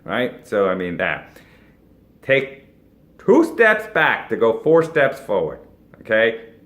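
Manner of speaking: usual speaking voice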